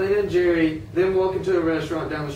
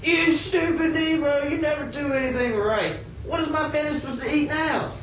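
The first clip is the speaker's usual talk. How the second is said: angry yell; 10 semitones higher than usual. Acted. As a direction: yell down at her over the broken dish. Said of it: "the master yells at her".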